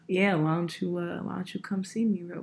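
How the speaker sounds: half-hearted imitation of a flirty artist; mock sexy voice. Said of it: "he put on, like, his super sexy voice".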